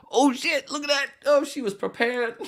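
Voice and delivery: high-pitched, cracking up as you talk; laughing amazement. Real, not acted. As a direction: squeal it out on the edge of laughter. Cracking up at a picture.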